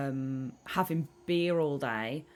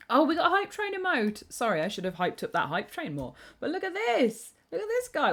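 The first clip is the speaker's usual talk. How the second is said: much higher, delighted squeal; excited squeal; 10 semitones higher, 3 dB louder than usual. Real, not acted. Streamer spots a new emote and squeals excitedly.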